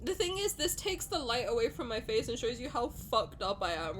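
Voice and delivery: ordinary talk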